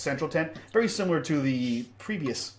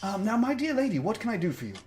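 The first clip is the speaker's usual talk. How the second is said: smooth host voice; 9 semitones higher, faster than usual. Acted. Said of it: "he says".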